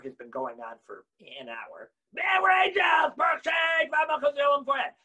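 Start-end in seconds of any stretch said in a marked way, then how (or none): 2.1-4.9 loud, high exclaimed proclamation; triumphant high shout. Acted. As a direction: shout it out like an angel. Mock outrage at saying it loud.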